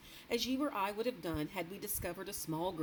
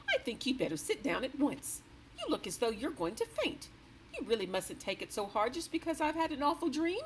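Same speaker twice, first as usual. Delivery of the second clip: sharp, rather sharply; 9 semitones higher, 3 dB louder than usual. A narrator's reading. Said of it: "rather sharply".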